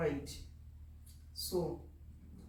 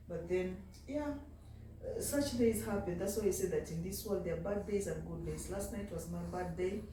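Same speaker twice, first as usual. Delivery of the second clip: ordinary talk, nothing marked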